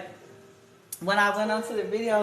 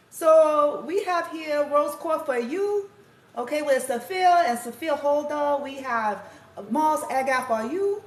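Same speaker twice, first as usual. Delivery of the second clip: high, slow, put-on broken-English accent; playful imitation of a broken-English accent, slow and high; imitated foreign accent; 7 semitones higher, 6 dB louder than usual. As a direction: mimic the foreign girl's accent, slow and sing-song, breaking into a laugh. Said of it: "I'm gonna do it right now for you guys".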